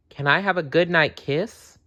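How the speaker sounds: put-on a small child asking for a kiss. A narrator's reading. Sally asking her mother for a kiss, light child voice.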